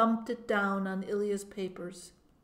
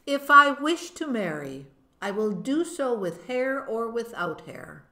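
higher and louder, firm; defiant; 3 semitones higher, 7 dB louder than usual. A narrator's reading. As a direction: stand up to the official.

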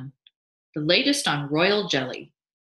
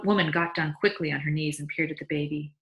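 ordinary delivery

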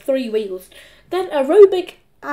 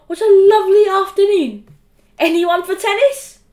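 loud, higher, jolly announcement; cheery dad; 8 semitones higher, 8 dB louder than usual. A narrator's reading. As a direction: invite the whole house to play.

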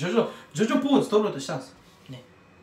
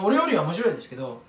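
ordinary delivery

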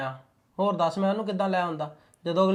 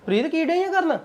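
high call across the house; shouted question to wife in the house; 9 semitones higher than usual. Acted. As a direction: call her from outside, pitched high. Calls wife from outside.

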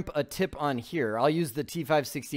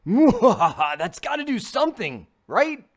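laughing, higher and louder; 7 semitones higher, 6 dB louder, slower than usual. Real, not acted. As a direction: chuckle through the line. Laughs at own plan.

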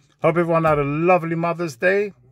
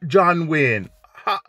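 put-on Jamaican accent; 4 semitones higher, slower than usual. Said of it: "He goes".

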